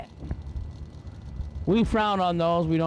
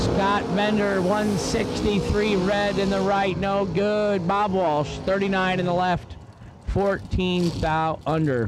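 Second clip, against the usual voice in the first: race announcer; 4 semitones higher, slower than usual. Acted. Announcer to the grandstands, calling each run's numbers in an announcer read. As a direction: drag strip PA announcer calling times.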